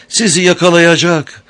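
high, loud panicked scream; frantic with fear. A narrator's reading. Amber watching the crocodile near Penrod, panicked. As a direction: shout out in sheer terror. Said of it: "Amber korkudan delirmişti".

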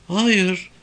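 put-on high girl's voice; young girl. A narrator's reading. Girl answers police questioning, high young voice.

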